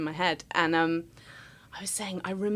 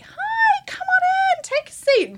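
much higher, bright sing-song welcome; convivial staff greeting; 6 dB louder, slower than usual. Retold or imitated. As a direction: greet like a cheery host inviting you in. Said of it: "Very convivial".

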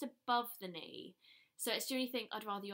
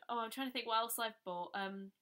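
ordinary talk

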